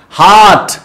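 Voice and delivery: हार्ट said with a long open "haart" vowel; हार्ट drawn out clearly for students to repeat; teacher's pronunciation. Shown as a demonstration. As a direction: model हार्ट as "haart", not "hurt". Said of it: "बोल के देखिए".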